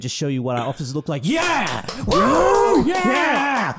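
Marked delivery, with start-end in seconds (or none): 1.2-3.8 high loud whooping cheers; mock crowd cheer. Imitating the clapping audience guy, loud whoops. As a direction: cheer like a hyped fan in the audience.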